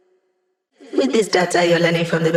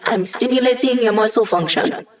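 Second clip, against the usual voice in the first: ordinary delivery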